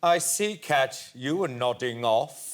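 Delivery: slow, lightly imitated inquisitor; Stephen Fry. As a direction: deliver a measured mock accusation, lightly imitating him. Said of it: "And then Stephen went".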